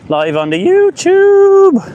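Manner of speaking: squeaky high, slow and loud on YouTube; high falsetto call. Acted. Telling viewers he's live, in a high falsetto jingle. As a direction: pipe up like a jingle. Announcing the livestream platforms.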